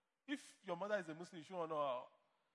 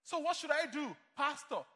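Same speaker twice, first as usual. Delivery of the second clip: higher, louder pleading question; imitated congregant; 6 semitones higher, 6 dB louder, slower than usual. A churchgoer asking the pastor what to do, pleading. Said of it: "congregant asking the pastor".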